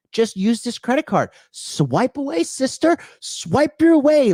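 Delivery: high, rising sing-song mockery of a credit card ad; sarcastic falsetto ad voice; mock sales pitch.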